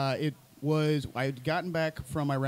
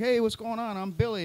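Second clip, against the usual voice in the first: friendly greeting; 7 semitones higher than usual. Retold or imitated. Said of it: "I'm like".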